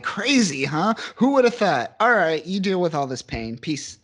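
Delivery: high mock-amazed taunt, then breezy sign-off; cranked-up sarcasm. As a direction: imitate a smug heroine, dripping with sarcasm. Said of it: "the sarcasm is, like, cranked to eleven".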